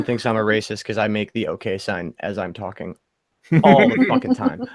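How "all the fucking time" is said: mock exasperation. Exaggerated outburst about hand gestures.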